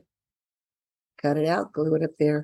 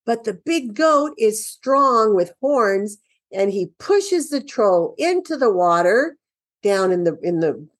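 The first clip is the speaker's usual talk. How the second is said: ordinary talk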